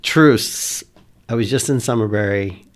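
loud, projected parley; non-alarming but audible. Acted. Player declaring peaceful intent from the treetop, loud measured hail. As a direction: call down firmly so it carries. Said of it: "so I'm gonna say".

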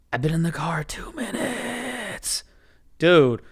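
slow, strained whispered complaint; exasperated whisper. Retold or imitated. Passenger reacting to driver's life story, exasperated whisper. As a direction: whisper it slowly in disbelief, stretching the end.

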